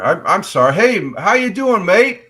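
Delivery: high, loud cheery "how you doing, mate"; excited friendly greeting. Real, not acted. Greeting Australian viewer excitedly.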